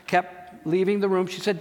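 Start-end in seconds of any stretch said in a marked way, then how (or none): none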